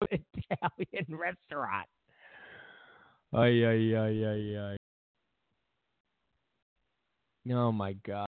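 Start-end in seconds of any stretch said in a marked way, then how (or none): 3.3-7.5 exasperated groan. Real, not acted. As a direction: groan it out, fed up.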